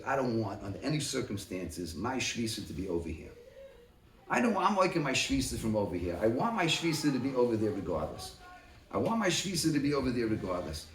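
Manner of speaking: lightly voiced man insisting on his choice; quiet insistence. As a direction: drop down low, stubborn and firm. Voicing the man's stance quietly.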